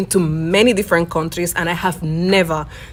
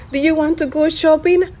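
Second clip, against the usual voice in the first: much higher-pitched mock mother-in-law; mother-in-law's voice; 9 semitones higher than usual. Mother-in-law invites her shopping, high quoted voice.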